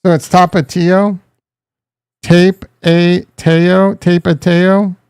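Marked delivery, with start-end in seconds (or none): 2.2-5.0 Tape a Teo as TAPE-ay-TAY-oh; very slow, Tape a Teo in separate pieces; deliberate mispronunciation. Shown as a demonstration. Streamer trying a pronunciation, Tape a Teo sounded out slowly. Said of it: "how do you say it".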